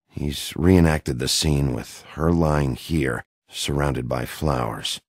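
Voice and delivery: ordinary talk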